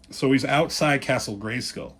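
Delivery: usual speaking voice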